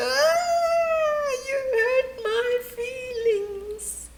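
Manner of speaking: high wailing cry. Acted. Hippo wailing at a compliment, high and crying. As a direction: wail it out, voice way up, slow. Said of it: "she would wail".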